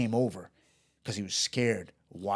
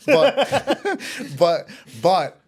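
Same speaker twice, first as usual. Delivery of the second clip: real high, loud burst of laughter; laughing; 11 semitones higher, 13 dB louder, much slower than usual. Real, not acted. Storyteller cracks up before his best story, loud laughing.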